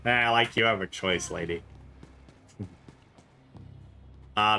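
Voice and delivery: ordinary talk, nothing marked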